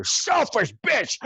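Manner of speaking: squealed mock insult; nasty aside. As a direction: spit the insult once their back is turned. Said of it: "he would go".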